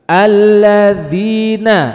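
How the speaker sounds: Alladzina slow, the double l pressed firmly, not floating; firm clear Alladzina. Shown as a demonstration. Teacher showing firm articulation to students, slow and pressed. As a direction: land each sound of Alladzina solidly. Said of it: "tapi ditandeskan".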